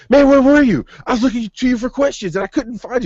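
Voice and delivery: high, loud mock call-out; retold loud greeting. Track runner scolding him, high loud mock call. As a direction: call out to him across the room. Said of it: "He's like".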